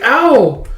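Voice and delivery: high, loud "ow"; sharp mock cry of pain; acted pained yelp. Connie is bumped by a running kid, pained yelp.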